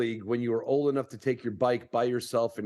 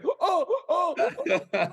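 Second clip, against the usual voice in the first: laughing mock gasp; much slower than usual. Laughing mock reaction to coaches.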